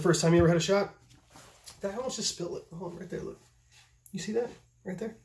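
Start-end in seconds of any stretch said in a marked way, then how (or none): 1.8-5.2 hushed. Real, not acted. Nearly spilling the shot.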